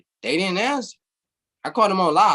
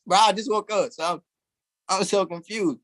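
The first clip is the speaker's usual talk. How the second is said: normal talking voice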